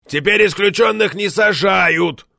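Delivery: loud, slow, high bellow. A narrator's reading. Member explaining to a deaf colleague, bellowing slowly. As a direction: bellow every word into a hearing aid. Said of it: "гаркнул в слуховой аппарат".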